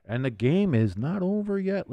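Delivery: normal talking voice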